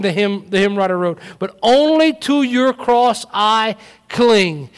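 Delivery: loud, high, impassioned sermon; fiery pulpit proclamation; preaching at full force. Acted. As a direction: build and hammer each line to the congregation. Preaching climax.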